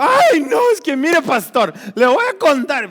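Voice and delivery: high, loud squealing church lady; shrill-voiced excited sister greeting the pastor; gushing church lady. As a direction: gush over the pastor like an overeager lady. Church lady interrupts again.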